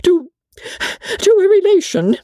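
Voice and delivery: very high, halting timid voice; falter, then squeak it out; frightened companion, pitched right up; nervous faltering. A narrator's reading. Briggs stammers out the news.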